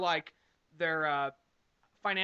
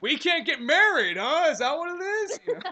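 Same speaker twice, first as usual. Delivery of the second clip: loud, higher mock mutant rant; Beast voice; 3 semitones higher, 7 dB louder than usual. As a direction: play the offended mutant. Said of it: "introduce you to my friend, Beast".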